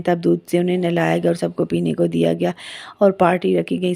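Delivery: usual speaking voice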